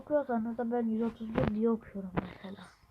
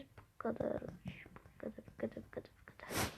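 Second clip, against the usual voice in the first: soft whisper, softer and softer; 11 dB quieter than usual. Real, not acted. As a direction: whisper it as you point out the range. Whispering while showing range.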